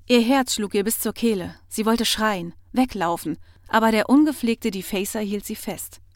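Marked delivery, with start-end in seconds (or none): none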